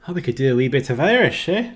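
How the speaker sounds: attempted Irish slipping into Scottish; lilting Scottish accent. Shown as a demonstration. Streamer trying out Irish for fun, Scottish accent. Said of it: "No, that's Scottish again".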